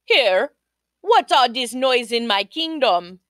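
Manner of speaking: put-on loud, commanding young king; king's demand. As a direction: boom it out like a king. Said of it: "Says he".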